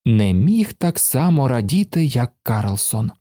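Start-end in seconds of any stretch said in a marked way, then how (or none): none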